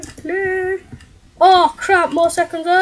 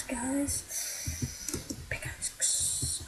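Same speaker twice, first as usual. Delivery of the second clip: whisper; 3 semitones lower, 19 dB quieter, much slower than usual. Real, not acted. Quietly realising he needs a tool.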